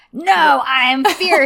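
mock fierce. Hedgehog man acting tough.

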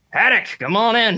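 gruff colonel calling through a door. Acted. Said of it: "you hear a gruff voice".

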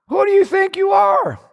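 high-pitched preacher's challenge; indignant shout. Acted. Preacher challenges those opposing God.

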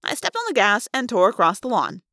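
usual speaking voice

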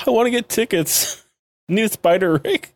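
put-on high, childish begging; put-on pouty crybaby voice; mock crybaby. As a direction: whine for it like a little kid. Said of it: "such a crybaby here".

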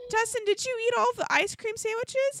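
high-pitched, incredulous questioning; mock accusing question. Wife asking husband who ate the sandwiches, mock accusing. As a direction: retell it as a high-voiced accusation.

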